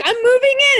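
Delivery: cheerfully barge in uninvited; high chirpy announcement. Pretend. Alexa telling Bray she's moving in, high and loud. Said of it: "she's like".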